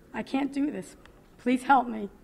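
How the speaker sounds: desperate plea to her mother. Retold or imitated. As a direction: beg for help in despair.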